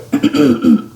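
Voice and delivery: loud nervous throat clear. Acted. Mister Dursley clears his throat nervously before asking his wife. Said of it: "He cleared his throat nervously".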